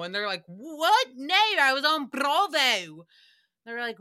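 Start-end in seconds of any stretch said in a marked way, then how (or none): 0.5-3.1 loud, high, indignant mock Aussie; drawn-out, hammed-up Australian vowels; bad Australian accent. Host playing a Bravo cast member, badly faked Australian accent. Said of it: "she can't do her accent".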